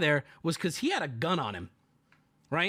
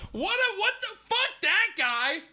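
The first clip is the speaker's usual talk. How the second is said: much higher, stunned voice; shocked disbelief. Retold or imitated. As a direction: react as if you can't believe it. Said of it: "I'm like".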